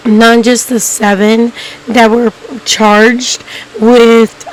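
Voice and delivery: normal talking voice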